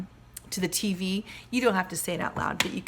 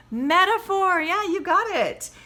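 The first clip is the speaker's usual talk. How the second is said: excited reveal; 11 semitones higher, 8 dB louder, slower than usual. Acted. Teacher revealing the answer.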